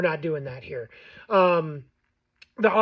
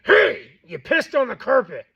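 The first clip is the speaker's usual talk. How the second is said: louder, chesty telling-off of the dog; firm deeper scold for the dog; 5 semitones higher, 6 dB louder, slower than usual. Shown as a demonstration. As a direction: drop into a deeper voice, near a growl but not quite. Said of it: "you're naturally gonna be deeper".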